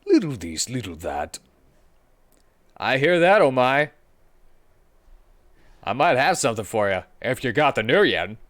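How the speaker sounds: fixer voice. Acted. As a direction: play the slick street dealer.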